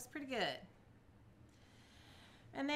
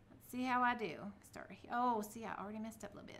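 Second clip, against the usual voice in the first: normal talking voice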